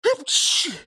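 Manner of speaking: high sharp sneeze; a comic squeaky sneeze. A narrator's reading. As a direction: sneeze it out suddenly. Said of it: "сказал Ниса Чих".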